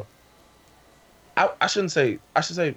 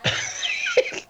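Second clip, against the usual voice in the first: high laugh; 4 dB quieter than usual. Real, not acted. Laughing at the yelling.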